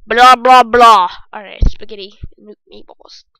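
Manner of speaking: ordinary talk, nothing marked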